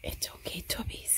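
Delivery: softly whispered comfort. Real, not acted. Mom soothing dog during thunder, whispered. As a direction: hush everything for the scared dog.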